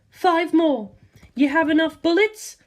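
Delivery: calm woman. Acted. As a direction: ask it coolly. Lisa Lisa voiced.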